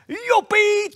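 high, bright whoop of joy; sudden happy yelp; joyful cheer. Acted. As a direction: squeal it like a happy kid. Learns he did not lose.

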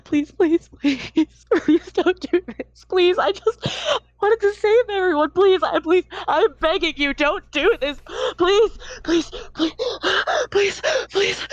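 high, desperate pleading voice; a terrified man begging not to die; begging for my life. Acted. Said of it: "he is begging for his life".

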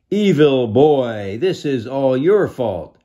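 loud, high, slow accusing jeer; blaming crowd. Acted. The crowd blaming Petros, loud jeering. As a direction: jeer at him as an angry mob. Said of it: "the crowd started booing and blaming".

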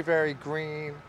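usual speaking voice

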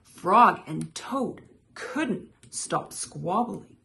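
ordinary delivery

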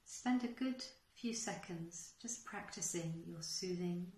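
unhurried guide with long pauses between phrases; guided meditation. Acted. Teacher guiding listeners to settle into their breath, guided meditation. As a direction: lead them gently through a breathing exercise.

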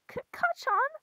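high, stuttered, halting call; high-pitched questioning stammer; startled character. Acted. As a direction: squeak the name out nervously. Nervous character voice in skit.

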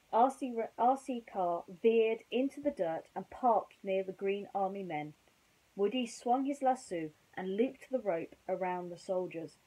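usual speaking voice

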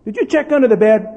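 loud, high pointed question; loud testing call. Retold or imitated. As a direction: test the boy with a shout. Dad calling to son.

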